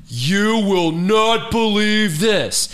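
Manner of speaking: high, drawn-out imitation of excitement; mock excited. Wife texting big news to husband, hammed-up excitement. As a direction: stretch each word in a high, hammed-up thrill. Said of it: "She's so excited to text me".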